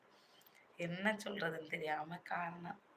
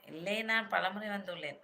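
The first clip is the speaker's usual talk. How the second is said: ordinary talk, nothing marked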